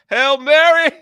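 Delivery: shouted through laughter; laughing shout. Retold or imitated. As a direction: yell it while cracking up.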